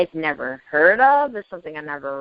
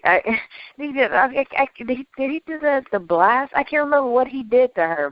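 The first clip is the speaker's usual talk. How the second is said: ordinary talk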